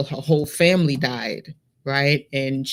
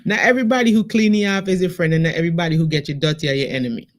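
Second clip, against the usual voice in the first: quick, lilting Vincentian Creole; Vincentian patois; much faster than usual. Shown as a demonstration. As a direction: rattle the saying off in Saint Vincent patois. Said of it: "let me say it in, in our patois".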